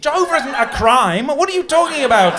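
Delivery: high, loud, outraged shouting; indignant yelling tirade at door-knockers; furious rant. Retold or imitated. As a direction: rant it out in mock fury. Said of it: "The media was like".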